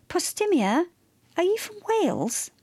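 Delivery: high-pitched, rising question; lifted, inquiring tone; asking. A narrator's reading. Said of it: "Maeve asked".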